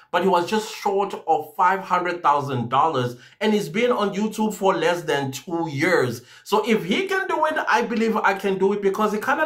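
ordinary delivery

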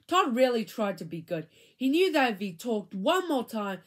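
usual speaking voice

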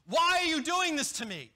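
acting out a grumbling believer crying why; voiced complaint to God. Preacher voicing complaint to God.

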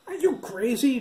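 high, loud outraged shout; cry. A narrator's reading. Boy yelling at the cop aiming at him, loud outraged shout. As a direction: yell at the cop in disbelief. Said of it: "Jack cried".

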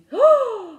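loud, high gasp of realisation; sudden gasp. Retold or imitated. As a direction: gasp as it suddenly hits you.

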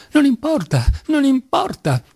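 high-pitched, pained exclamation; pained, pleading high outburst; anguished cry. A narrator's reading. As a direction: cry it out high, voice torn with pain. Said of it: "esclamò Ilyusha con dolorosa lacerazione nella voce".

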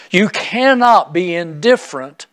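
normal talking voice

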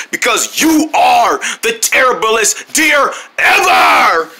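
slow, emphatic insult; mean taunt. Acted. Mean guy insulting Joe the deer, taunting. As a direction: drag out each word, building to a shout.

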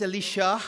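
"Elisha" with an "ee" in the middle; Hebrew-style pronunciation. Shown as a demonstration. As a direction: say "Elisha" as eh-LEE-sha. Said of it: "if you look at it in Hebrew".

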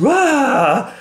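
high ghostly wah; a startled shriek; a sudden high wail as a headless figure appears; mock scream of fright.

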